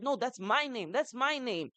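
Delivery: put-on high-pitched indignant child's protest; child's protest, voice pitched well up; upset young daughter. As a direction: imitate an upset little girl objecting. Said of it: "My older daughter got so upset".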